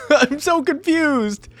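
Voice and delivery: high, drawn-out baffled wail; helplessly confused and laughing; laughing bewilderment. Real, not acted. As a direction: stretch it out through laughter.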